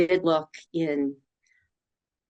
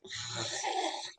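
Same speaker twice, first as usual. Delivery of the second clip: weary sigh; 11 dB quieter than usual. Retold or imitated. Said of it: "I'm like".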